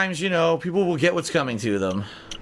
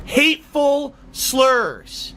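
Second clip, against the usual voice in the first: drawn-out, higher-pitched mock disbelief; mocking repetition; 7 semitones higher, 4 dB louder, much slower than usual. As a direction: stretch each word out incredulously. Mockingly repeating the accusation.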